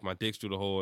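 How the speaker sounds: ordinary delivery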